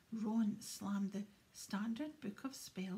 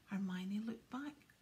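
ordinary delivery, nothing marked

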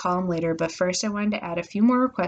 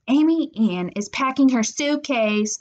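slow, raised sing-out; sister yelling tattle; 5 semitones higher, 4 dB louder, slower than usual. A narrator's reading. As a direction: call it out to the household. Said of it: "Alexis yelled from our doorway".

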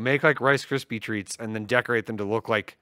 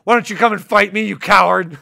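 taunting challenge; 7 semitones higher, 9 dB louder than usual. Retold or imitated. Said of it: "a reply from Danny DeVito".

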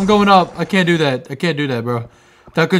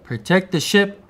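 reading an inscription; 5 semitones lower, 7 dB quieter than usual. Acted. Player reading the ship inscription aloud, hushed and solemn. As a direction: read it like an old carved warning.

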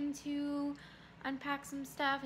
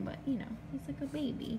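usual speaking voice

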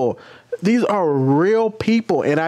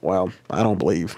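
monotone impression; 8 semitones lower than usual. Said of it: "Very monotone".

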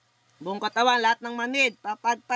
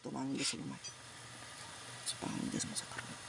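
fast hushed whisper of spell words; whispered incantation; 9 semitones lower, 15 dB quieter, faster than usual. Real, not acted. Healer whispering a quick prayer over the patient.

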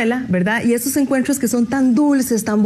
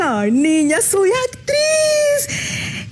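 much higher, hugely drawn-out proud cry; 10 semitones higher, much slower than usual. Retold or imitated. The grown pupil proudly tells her teacher, high and stretched. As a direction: stretch it out in proud, squealing triumph.

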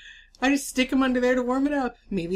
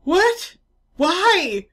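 put-on high, loud horrified kid shriek; 8 semitones higher, 7 dB louder, slower than usual. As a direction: squeal it like a grossed-out boy. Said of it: "And he's like".

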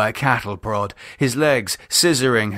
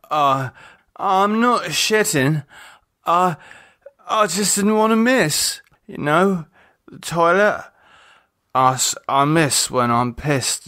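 put-on slurred drunk; 6 semitones higher than usual. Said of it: "His voice was slurry".

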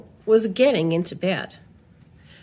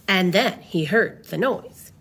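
ordinary delivery, nothing marked

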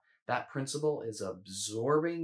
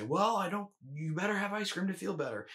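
higher, insistent craving voice that won't back down; pushy inner voice; 9 semitones higher, 3 dB louder than usual. Shown as a demonstration. Inner food voice snapping back at the dieter, higher and insistent. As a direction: push hard like a stubborn inner voice.

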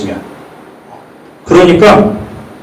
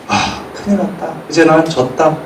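despairing thought; 8 dB quieter than usual. Retold or imitated. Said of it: "약간 이런 생각이 들 것 같아요".

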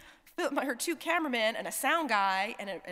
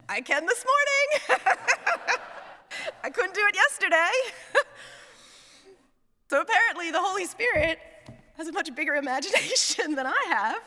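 real laughter, higher and louder; laughing; 9 semitones higher, 6 dB louder than usual. Real, not acted. Chaplain telling the audience the joke, laughing out loud. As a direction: laugh through the punchline. Teller laughing at her own line.

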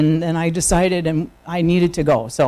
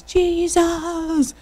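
retold scream; 11 semitones higher, 4 dB quieter than usual. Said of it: "screaming out at the top of my lungs".

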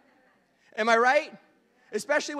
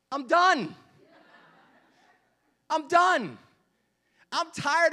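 loud, high, exasperated I'm done; exasperated outburst, higher and louder; fed-up shout; 7 semitones higher, 5 dB louder, slower than usual. Real, not acted. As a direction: shout it like you've had enough of snow. Fed up with endless winter.

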